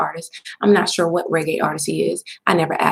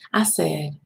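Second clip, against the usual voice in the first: usual speaking voice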